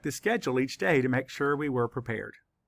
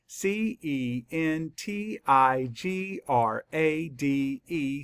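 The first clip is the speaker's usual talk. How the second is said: normal talking voice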